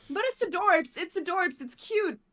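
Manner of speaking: high, loud imitation of a gusher; mock gushing. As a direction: coo over it like an admirer. Acting out admirers of the accent.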